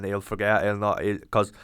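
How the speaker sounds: normal talking voice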